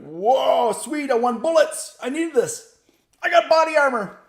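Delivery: high, loud, overexcited soldier voice, hammed up. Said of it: "He's like".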